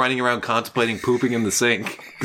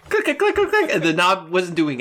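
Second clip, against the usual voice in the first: high-pitched imitated clicks of a stuck handle; clicking noises; 5 semitones higher than usual. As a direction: click it out quickly like a jammed knob. Jiggling a stuck door handle.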